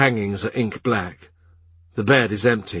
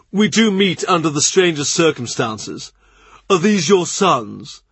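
higher, amused courtier; lightly teasing, raised voice; Norris voiced; 6 semitones higher, 4 dB louder than usual. Norris teases Cromwell about his companions, lightly raised and amused.

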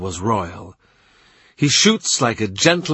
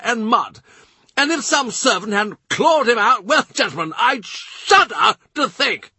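much higher, pressing, urgent storytelling; furious and earnest; 12 semitones higher than usual. A narrator's reading. Norfolk pressing his tale on the courtiers, furious and high. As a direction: keep pushing on in agitation. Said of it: "Wincing but furious, earnest, he presses on".

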